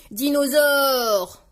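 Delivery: hollered word. A narrator's reading. George presents his costume to his sister, yelling. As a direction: bellow it slowly as a little dinosaur. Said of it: "hurle-t-il".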